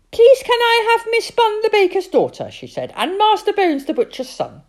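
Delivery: put-on high, loud, insistent child voice; a pushy little girl pressing her dad to play; bossy little girl. Sophie nags her father to take his turn, high and loud.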